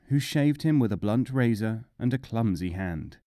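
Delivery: usual speaking voice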